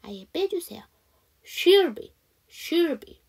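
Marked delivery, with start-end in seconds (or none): 1.4-3.2 higher, louder "she'll be" with the vowel nearly silent; reduced contraction. Shown as a demonstration. Teacher models contraction, vowel-less "she'll be". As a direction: squash "she'll" into one short syllable. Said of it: "이렇게 해주시면".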